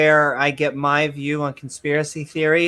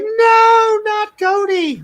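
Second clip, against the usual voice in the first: put-on high, loud kid's wail, much higher and louder; panicked Cartman; 5 dB louder, slower than usual. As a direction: shriek it like a frantic child losing his pet. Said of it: "And Cartman's like".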